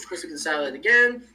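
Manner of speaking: ordinary talk, nothing marked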